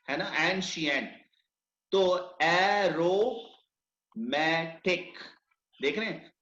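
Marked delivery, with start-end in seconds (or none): 2.4-5.1 "aromatic" drawn out slowly in four separate beats; syllable by syllable. Shown as a demonstration. Teacher showing a four-syllable word, syllable by syllable. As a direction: stretch and split "aromatic" into its syllables. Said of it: "चार syllable".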